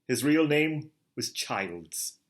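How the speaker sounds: usual speaking voice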